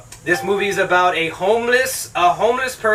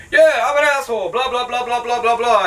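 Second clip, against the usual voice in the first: high, hammed-up jerk impression; mock obnoxious jerk; 6 semitones higher than usual. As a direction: parody a loudmouth jerk, pitched way up. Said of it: "and he's like".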